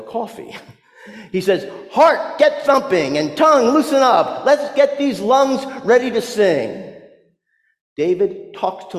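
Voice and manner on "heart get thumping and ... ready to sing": higher-pitched rallying orders to the body; rousing pep talk. Acted. David readying his insides for worship, rousing pep talk. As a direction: rouse each body part for singing. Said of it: "holds a meeting of his insides".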